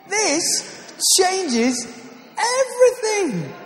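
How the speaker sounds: hammed-up salesman shouting the slogan; infomercial climax. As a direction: peak of the pitch, drawn-out and exultant. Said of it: "not a sales pitch".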